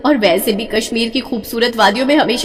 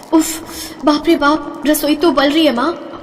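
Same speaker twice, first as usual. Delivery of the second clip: exasperated groan; 6 semitones higher than usual. Acted. Daughter complains of kitchen heat.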